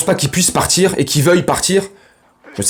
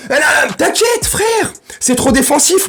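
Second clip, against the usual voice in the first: réplique criée; 9 semitones higher, slower than usual. Acted. Shouted retort to imagined commenter.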